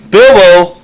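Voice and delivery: normal talking voice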